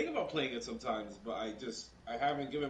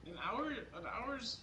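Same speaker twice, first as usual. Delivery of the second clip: high incredulous question; surprised, disbelieving; 10 semitones higher, 3 dB quieter, much slower than usual. Real, not acted. Incredulous at one hour.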